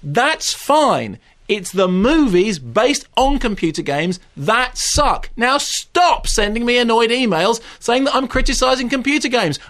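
high-pitched, pleading exasperation; exasperated rant. Real, not acted. Critic begging letter writers to listen, exasperated high-pitched rant. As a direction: hammer the point home, thoroughly fed up. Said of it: "I bet you don't shout".

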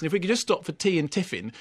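ordinary delivery

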